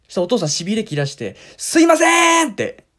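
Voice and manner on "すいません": high, loud call to the staff; calling out loudly. Retold or imitated. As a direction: raise your voice to summon a waiter. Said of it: "声張り上げて。". (店員を大声で呼ぶ)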